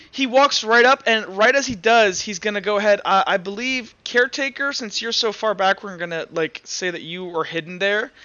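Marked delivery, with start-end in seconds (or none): none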